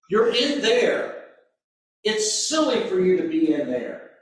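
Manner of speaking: ordinary talk, nothing marked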